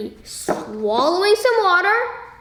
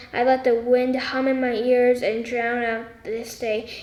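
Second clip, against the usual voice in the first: ordinary delivery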